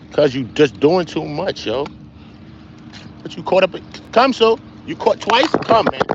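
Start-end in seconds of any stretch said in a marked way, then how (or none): 3.2-6.0 high, loud summons; calling the dog. Real, not acted. Streamer to the dog getting caught up, calling out high and loud. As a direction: call the dog over, pitch raised.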